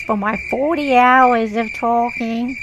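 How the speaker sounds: put-on irritating lawyer voice, badly faked American; mock an annoying American lawyer; annoying Cashman voice. Lawyer demanding pay for hours of talking, mocking impression. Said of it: "She had a voice kinda like this".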